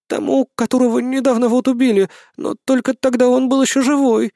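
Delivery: high, careful female character voice; timid wife, explaining. Acted. Woman explains whom they wrote.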